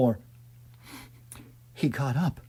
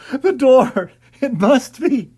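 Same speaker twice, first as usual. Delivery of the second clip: excited relief; 8 semitones higher, 8 dB louder, slower than usual. Acted. Finding the opening in dark.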